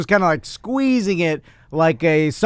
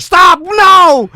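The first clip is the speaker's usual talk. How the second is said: high, loud cry of alarm; alarmed shout; 13 semitones higher, 8 dB louder than usual. Retold or imitated. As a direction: yell stop at once. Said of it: "Chris is like".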